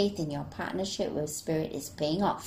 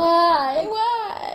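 high, hammed-up crying why; mock wail; 10 semitones higher, 6 dB louder than usual. Joking about crying through hard months.

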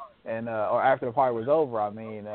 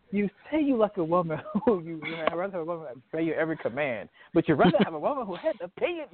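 quoting a character; 5 semitones higher, faster than usual. Retold or imitated. Quoting movie character's line.

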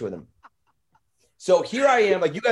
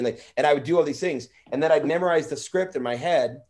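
normal talking voice

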